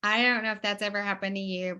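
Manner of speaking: ordinary delivery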